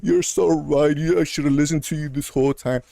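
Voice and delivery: hammed-up "you were so right"; mock concession. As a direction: play at grovelling, mockingly. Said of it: "You want me to say".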